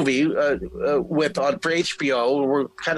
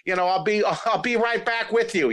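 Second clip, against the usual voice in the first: higher-pitched, casual showing off; breezy calling out; 6 semitones higher than usual. Retold or imitated. Young performer waving to John from the crowd, breezily. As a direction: imitate a breezy call across a crowd. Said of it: "I was waving to John, saying".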